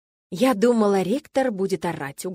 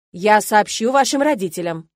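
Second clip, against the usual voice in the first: firm, final, louder statement; curt cut-off; 7 dB louder than usual. A narrator's reading. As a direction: cut him off coldly. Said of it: "отрезал ректор".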